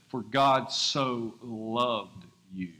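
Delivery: very slow, tender "For God so loved you"; slow reverent quote, "God" drawn out; correct slow reading. Shown as a demonstration. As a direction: let every word land slowly. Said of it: "It says".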